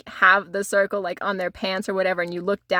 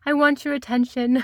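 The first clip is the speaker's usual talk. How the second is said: prissy; 2 semitones higher than usual. Retold or imitated. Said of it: "so prissy".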